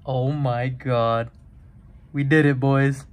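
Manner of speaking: thrilled cheer; excited. Real, not acted. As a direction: celebrate the big pull. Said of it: "I'm literally breathless".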